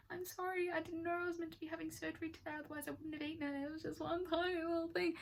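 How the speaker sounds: very high, fast, small pleading voice; squeaky apology. Retold or imitated. Apologising to the nurse in a very high, fast, meek voice. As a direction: shrink into a tiny squeaky sorry.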